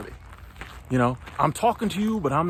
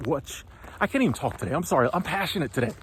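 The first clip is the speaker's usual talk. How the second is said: normal talking voice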